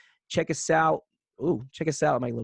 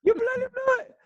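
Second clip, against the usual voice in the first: very high, louder cartoon Brit; mock English accent; 8 dB louder than usual. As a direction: squeal it like a fussy posh Englishman. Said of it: "anytime I do any accent".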